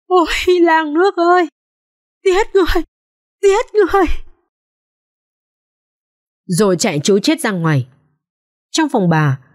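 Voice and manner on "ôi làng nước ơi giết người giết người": much higher, screaming for help; terrified scream. A narrator's reading. Maid finding the bodies, screaming in terror. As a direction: shriek out in horror. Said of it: "hoảng quá và hét lên".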